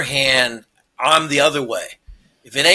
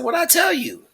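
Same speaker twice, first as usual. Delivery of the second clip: dad teasing; 11 semitones higher, 6 dB quieter than usual. Retold or imitated. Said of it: "I said".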